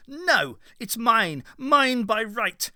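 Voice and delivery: high, loud insistent claim; young Vale shouting his claim; defiant shout. A narrator's reading.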